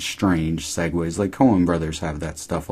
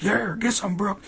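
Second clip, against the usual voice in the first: cheering; 3 dB quieter than usual. Retold or imitated. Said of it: "I'm like".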